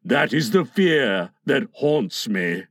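low, slow dejection; haunted captain, slow and low; dejected. A narrator's reading. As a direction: sink into brooding gloom. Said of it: "He was roused from this dejection".

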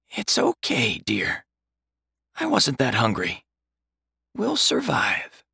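high, soft, frail reassurance; frail old mother. A narrator's reading. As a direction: comfort him through weakness. Dying mother comforts son.

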